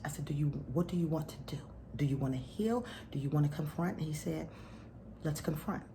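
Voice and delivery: soft, half-whispered, low; hushed retelling. Real, not acted. Wife recounting her talk with her husband, hushed. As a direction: tell it close and low.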